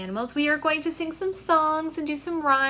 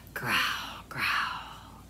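low whispered growl; 6 dB quieter than usual. Shown as a demonstration. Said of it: "make a big growl".

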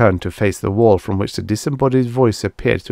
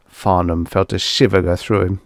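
ordinary delivery

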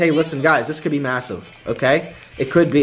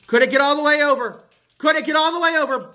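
high tense plea; 9 semitones higher, faster than usual. Real, not acted. Repeated building plea.

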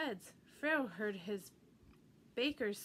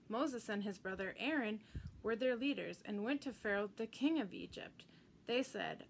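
plain reading voice

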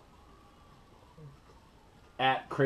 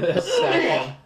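laughing; 8 semitones higher, 4 dB louder, slower than usual. Real, not acted. Laughs ending the segment.

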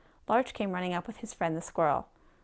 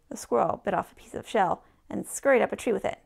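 ordinary delivery, nothing marked

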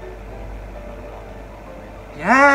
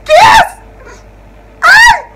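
very high, loud squeals of delight; excited squeal; 12 dB louder than usual. Real, not acted. Fan urging the couple to kiss, squealing high. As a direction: squeal it at the top of the voice.

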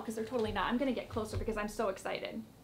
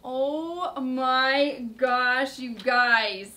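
excited; 6 dB louder, much slower than usual. Real, not acted. Reveal of Marshmallow.

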